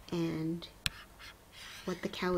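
unvoiced hushed "and" before a reveal; whisper. Shown as a demonstration. Teacher building suspense for class, whispered. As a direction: breathe it as a build-up.

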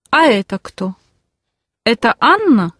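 ordinary talk

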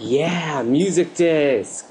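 high, drawn-out cheer of delight; overjoyed cheer. Real, not acted. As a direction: celebrate the loot, stretching it slowly. Excited over getting the disc.

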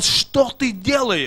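angry yell. Retold or imitated. Interpreter voicing the father's angry yell at his son. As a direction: interpreter hams up the father's fury. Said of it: "Он начал кричать на своего сына".

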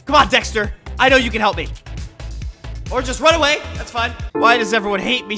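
normal talking voice

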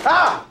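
sharp high yelp; startled cry. Real, not acted. Startled yelp at a pigeon in his apartment. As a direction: cry out as a bird flies at you.

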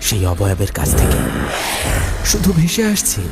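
plain reading voice

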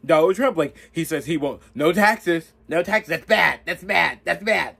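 high, loud mock-outraged newscaster; hammed-up media hysteria. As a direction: parody a panicky pundit clutching pearls. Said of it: "they be like".

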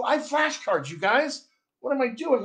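ordinary talk

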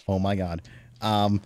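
normal talking voice

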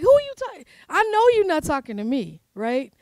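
high, loud, indignant mock Mary; sassy urban Mary. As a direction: play Mary as a sassy, offended young woman. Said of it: "making her sound very urban".

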